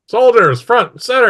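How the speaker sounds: high, loud military order; shouted command. Acted. Mistress to her soldiers, high shouted command. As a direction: call the troops to attention.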